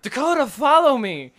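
high, loud call to follow. Retold or imitated. Girl running out the back door, calling loudly.

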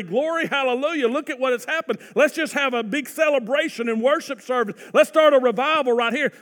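hammed-up hallelujah shout; imagined jubilant crowd. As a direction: cheer like a revival crowd. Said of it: "you would think that they would say".

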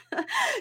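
short high chuckle; brief, soft laugh at herself. Real, not acted. Laughs after her exaggerated quote.

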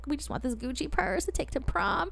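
a light hint of a meek teenage girl, hushed; naive teen voice. Telling the story of buying a fake purse, lightly mimicking her meek teen self. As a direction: play it small and innocent, just barely put on. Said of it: "me and my girlfriend were like".

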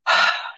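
imitated exasperated sigh; wordless sigh of frustration; frustrated sigh. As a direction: let out a fed-up breath, imitating him. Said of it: "just, like, frustrated".

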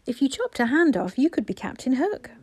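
put-on high, lively child voice; eager little boy. A narrator's reading. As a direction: pipe up like a small boy with an idea. Small boy suggests to guest.

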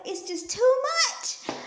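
high, drawn-out complaint; mock overwhelmed. Speaker acts out praying for help, high overwhelmed cry. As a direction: stretch it out way up high.

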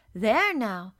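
loud, bright burst of delight; a happy exclamation; exclaiming. A narrator's reading. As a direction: burst out with glad surprise. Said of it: "she exclaimed".

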